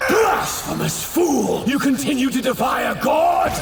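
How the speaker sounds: high screamed indignation; outraged yell. Acted. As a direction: shriek at the fool defying a god. Villain outraged at defiance.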